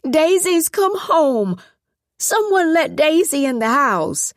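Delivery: high, loud, confused calling out; an old woman crying out in joyful confusion; excited yelling. Acted. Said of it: "excited and confused by the knocking".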